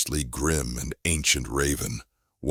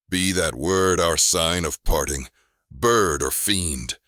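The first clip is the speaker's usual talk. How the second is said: loud shriek, higher pitch; 4 semitones higher, 6 dB louder than usual. A narrator's reading. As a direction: shriek it, slow on bird or fiend. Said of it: "I shrieked".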